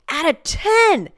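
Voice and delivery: high, loud and drawn out, bursting with eagerness; enthusiastic interjection. Retold or imitated. Telling the doctor she wants life at a ten, excited high shout. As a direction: blurt it out like the eager star pupil. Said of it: "I interject super enthusiastically".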